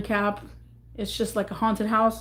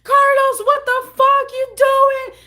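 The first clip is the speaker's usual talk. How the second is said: screaming high and loud at Carlos; shrill disbelieving yell; shocked outrage; 13 semitones higher, 7 dB louder than usual. Retold or imitated. Yelling at Carlos as he smashes her phone, high and loud. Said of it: "I was like".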